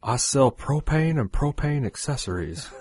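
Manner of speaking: slow, deliberate cartoon-dad voice; Hank Hill. Shown as a demonstration. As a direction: lay it out slowly like a proud propane salesman. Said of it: "I can't do Hank".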